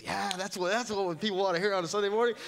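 high, sarcastic sing-song about what people want; sarcastic self-mockery. Pastor mocking his own sermon pick to the congregation, sarcastic.

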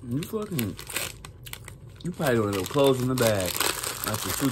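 chewing while talking; talking between bites of chips; mouth full. Real, not acted. Seen 2: Puffed cheeks, pursed chewing lips after eating chips at -2.

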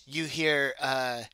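normal talking voice

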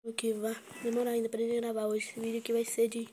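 ordinary talk

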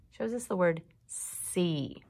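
ordinary talk

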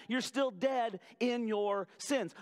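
usual speaking voice

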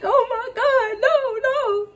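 hammed-up panicked crying no; retold panic. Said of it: "I'm like".

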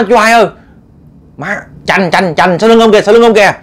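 high, stammering cry of alarm; panicked yelling. Acted. Men warn uncle of ghost behind him, panicked yelling. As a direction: yell a frantic warning, words tripping over each other. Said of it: "mọi người trên đây mới la làng lên".

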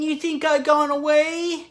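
high, level-pitched cartoon slacker voice; Shaggy. Proving a second impression remains, in a high slacker voice. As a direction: keep it high and even, without shouting. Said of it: "And then there's Shaggy".

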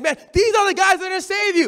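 imitating a pesky girl calling out; a hint of a heckling little girl; mock heckling girl. Girl heckling at Bible talk.

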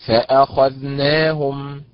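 فأخذناهم drawn out very slowly, stress on kha; syllable by syllable, stress on kha in فأخذناهم; a model reading of فأخذناهم; slow stress demo. Shown as a demonstration. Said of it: "فاعل".

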